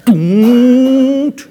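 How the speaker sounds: long, loud, rising don't; drawn-out mock scolding; emphatic parent. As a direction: stretch out a high warning "Don't". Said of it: "And emphatic face".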